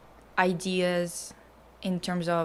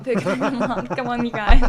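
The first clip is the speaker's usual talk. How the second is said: laughing through the words, getting louder; cracking up; 3 semitones higher, 8 dB louder than usual. Real, not acted. Joking to friends about a new profile pic, cracking up. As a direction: break into giggles mid-phrase.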